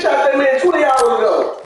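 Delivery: loud exasperated shout; yelling that his friend waited too long; mock outraged shout. Real, not acted. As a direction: shout at him for taking forever.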